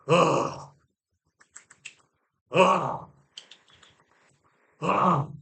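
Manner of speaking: long groan like a stressful orgasm; mock strained groan. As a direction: imitate a doctor's drawn-out, laboured sigh. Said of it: "very stressful orgasm".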